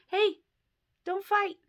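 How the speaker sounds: sharp, high call to the cats; scolding call. Real, not acted. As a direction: snap at the pets to stop. Calling to fighting cats.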